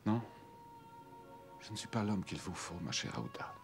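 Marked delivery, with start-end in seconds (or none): 1.6-3.5 whispering. Acted. Tender farewell to Aouda.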